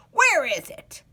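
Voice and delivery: put-on loud, cracked old man's demand; old man demanding the pumpkin; hollering old man. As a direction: demand it like a grumpy ancient. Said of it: "hollered the old man".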